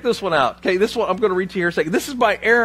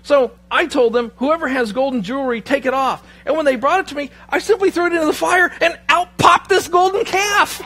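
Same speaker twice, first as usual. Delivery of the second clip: hammed-up excuse; 6 semitones higher, 4 dB louder than usual. Aaron explaining the golden calf to Moses, mock-innocent excuse. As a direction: pitch climbing into mock innocence.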